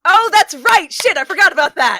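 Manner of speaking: loud, high burst of real dismay; sudden realization. Real, not acted. Player remembering a forgotten spell, loud exclamation. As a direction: exclaim as it suddenly comes back to you.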